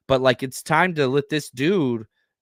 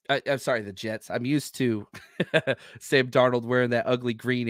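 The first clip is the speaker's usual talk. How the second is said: ordinary delivery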